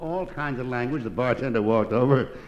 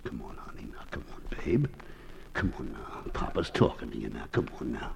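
put-on low, soft baby-talk to a pet; coaxing; 12 semitones lower, 5 dB quieter than usual. Parrot's owner begs it to talk, softly coaxing. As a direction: coax softly, dropping very low.

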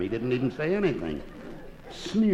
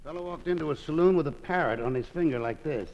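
normal talking voice

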